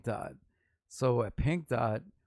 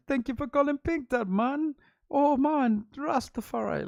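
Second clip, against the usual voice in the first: high, loud, bad Jamaican accent; hammed-up Jamaican phone greeting; terrible Jamaican accent; 7 dB louder than usual. Bored coworker answering the phone, worst fake Jamaican accent. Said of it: "it's like the worst Jamaican accent".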